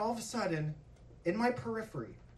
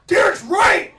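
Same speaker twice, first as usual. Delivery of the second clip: loud, high-pitched yelled agreement; coach's emphatic shout; 10 semitones higher, 15 dB louder than usual. Retold or imitated. Varsity coach backing the kid before the team, emphatic shout. Coach loudly backs Derek's outburst.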